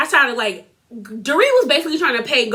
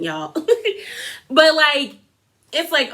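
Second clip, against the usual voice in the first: ordinary delivery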